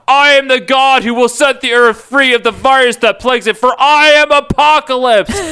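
hammed-up villain monologue. A narrator's reading. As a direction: rant like a world-ending god. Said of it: "Apocalypse monologued".

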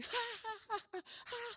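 high, soft mock laugh; put-on high giggle; imitated laughter. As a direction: laugh as onlookers cracking up. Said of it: "va de reírse".

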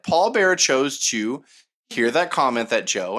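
usual speaking voice

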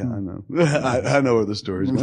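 usual speaking voice

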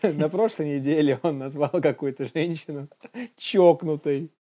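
ordinary talk, nothing marked

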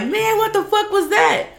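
mock disgusted surprise. Blindfolded man spitting out the cucumber, mock shocked. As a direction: react shocked to something in your mouth.

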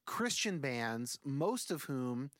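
usual speaking voice

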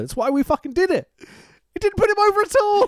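high-pitched exasperated rant; exasperated outburst. Retold or imitated. Host retelling the Kidman argument, rising to exasperated yelling. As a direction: build to a loud, high protest.

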